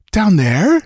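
high, shocked question; lightly acted aghast woman. A narrator's reading. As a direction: recoil at the idea. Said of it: "Valeria was aghast".

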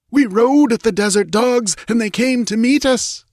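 high, agitated yell. A narrator's reading. Priest yells his battle report to the queen. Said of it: "yelled the priest".

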